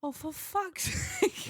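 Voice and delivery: high, drawn-out exasperation; slow, high-pitched cry of despair at the absurdity; exasperated. Real, not acted. Dismayed at clip's opening line.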